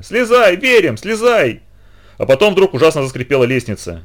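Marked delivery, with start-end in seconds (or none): 0.0-1.6 high, slow pleading call; зов криком. A narrator's reading. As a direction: call up urgently from below. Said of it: "кричали снизу Сева и Литка". (протяжно кричать снизу)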